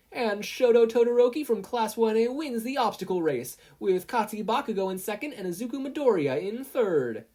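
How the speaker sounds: high, loud stadium announcement; announcer call. A narrator's reading. Present Mike announces the race results, loud announcer call. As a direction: call the winner like a sportscaster.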